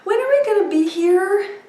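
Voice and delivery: put-on high, small child's question; little son's voice. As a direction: imitate a little boy asking innocently. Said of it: "I hear Daniel's little voice say".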